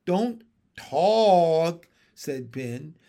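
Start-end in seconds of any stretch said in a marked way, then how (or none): 0.1-1.9 loud, drawn-out frog voice; frog husband ordering. Acted. Frog husband silences his arguing wife, loudly ordering.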